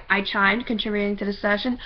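usual speaking voice

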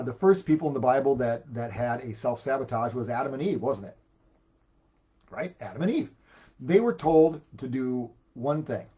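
usual speaking voice

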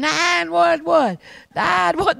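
high, loud and slow, like a man possessed; mock frantic scream. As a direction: yell it out like he thinks the devil is in him. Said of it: "He screamed".

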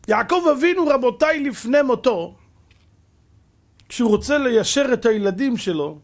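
high, loud fervent sermon cry; loud, high fervent preacher; preaching at full force. Acted. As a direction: lift the congregation with it. Preaching climax.

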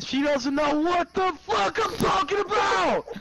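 furious yell at the game; ranting, yelling the last words; raging gamer. Real, not acted.

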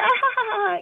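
very high mock giggle; imitating her laugh. Mother laughing off her son's punch, mock high giggle. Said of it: "and she's like".

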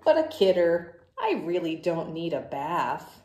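loud, brash pigeon voice, brushing it off; the pigeon, loud and sure of itself, to the kids; cocky pigeon. Acted. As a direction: play the stubborn pigeon who dodges the bath. Pigeon character in picture book.

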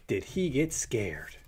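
ordinary delivery

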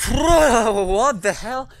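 loud, high and slow disbelief; real shaken outburst; stunned shout. Real, not acted. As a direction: drag it out in shock. Shocked after jump scare.

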